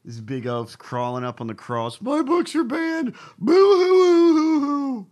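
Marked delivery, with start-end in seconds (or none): 2.0-5.1 high, whiny mock sobbing; hammed-up boo-hoo crying; fake crying. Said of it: "he's trying to look like a victim here".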